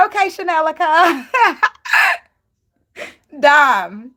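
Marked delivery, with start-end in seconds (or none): 0.1-1.3 higher, louder, sassy dismissive Okay; [name]'s voice. Retold or imitated. Retelling the reality show clapback, sassy and laughing. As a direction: mimic a reality star cutting someone down. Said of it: "She said".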